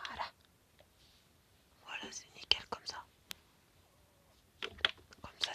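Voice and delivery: murmure sans voix, tout bas; chuchotement. Real, not acted. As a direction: chuchoter comme pour ne pas se faire entendre. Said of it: "Je sais pas pourquoi tu chuchotes". (chuchoté tout bas)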